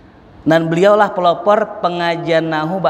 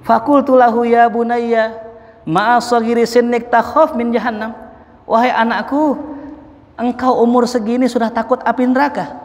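awed elder; 8 semitones higher than usual. Acted. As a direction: speak to the boy in wonder. Hasan speaks to child in awe.

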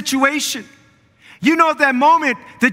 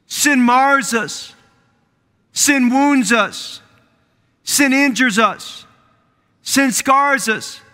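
slow emphatic preaching; 2 semitones higher, much slower than usual. Acted. Preacher hammers sin's effects.

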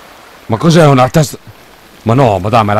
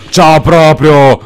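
drawn-out mocking ciao; slow, sarcastic farewell; mocking goodbye; 5 semitones higher, 8 dB louder, much slower than usual. Real, not acted.

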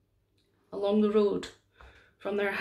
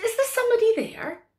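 frightened Mary; 11 semitones higher, 3 dB louder, faster than usual. Acted. Mary calls out in the dark.

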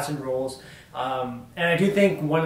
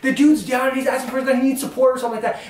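normal talking voice

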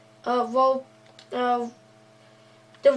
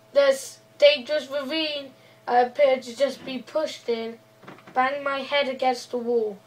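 ordinary delivery

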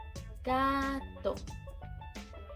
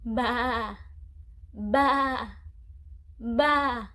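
sheep bleat imitation; 2 semitones lower, 4 dB louder, much slower than usual. Shown as a demonstration. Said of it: "What does the sheep say?".

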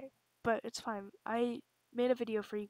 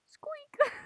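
squeak; 7 dB louder than usual. Acted. Voices a squeak in skit.

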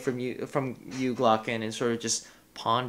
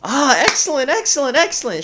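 much higher and louder, gleeful repeats; giddy delight; 11 semitones higher, 7 dB louder than usual. Acted. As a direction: squeal it out while skipping in a circle. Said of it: "she says, sort of skipping around".

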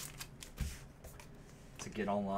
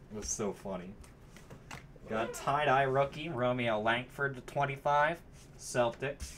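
normal talking voice

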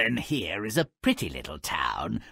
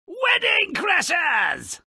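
gleeful cry; slower than usual. Real, not acted. Gleeful cry at crashed wedding.

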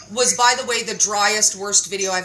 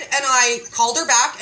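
usual speaking voice

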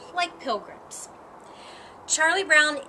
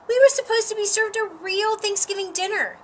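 higher and loud, still fuming; indignant protest; 7 semitones higher, 4 dB louder than usual. Acted. Patty defending herself to Marcy, still loud and fuming. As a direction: insist you were wronged.

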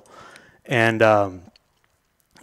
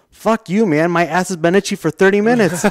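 higher-pitched, exasperated comeback; mock indignant retort; 6 semitones higher than usual. Armless dancer answers the man thanking him, mock indignant. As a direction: play the annoyed stranger snapping back, pitched up.

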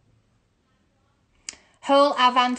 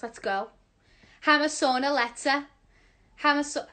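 usual speaking voice

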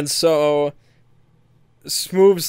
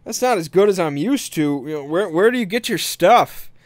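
higher-pitched needling, lightly acted; mock antagonizing; 4 semitones higher than usual. Buyer complaining to a dealer about the sample, mock antagonizing. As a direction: imitate a buyer provoking the seller. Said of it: "he starts to kinda antagonize".

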